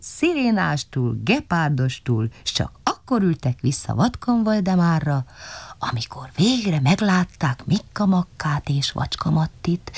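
usual speaking voice

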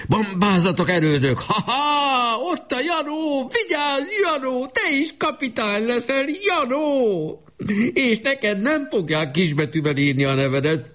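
very high, strained cries without extra volume; delirious little boy. A narrator's reading. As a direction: rave in a piercingly high child's voice, drawing out the calls. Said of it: "Most már hörögve beszélt". (nagyon magas, lázálomban kiáltozó gyerekhang)

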